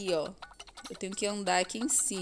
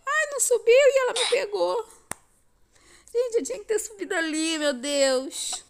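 dismayed wail; 12 semitones higher, 7 dB louder than usual. Real, not acted. Just got caught.

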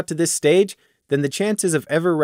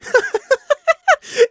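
short high gleeful laugh; 4 dB louder than usual. Acted. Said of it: "Prey almost felt like laughing".

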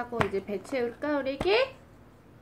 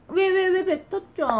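mock surprise; 8 semitones higher, 5 dB louder than usual. Real, not acted. Baby spat food out.

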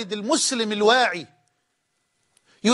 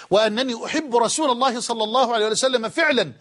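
normal talking voice

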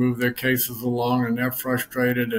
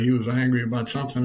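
ordinary talk

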